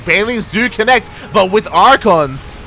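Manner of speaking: rising to a loud, high shout over the fight; real hype of a caster; excited call. Real, not acted. Casting a big fight.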